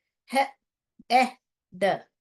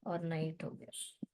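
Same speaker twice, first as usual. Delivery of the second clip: whisper; 5 semitones lower, 13 dB quieter than usual. Real, not acted. Teacher aside.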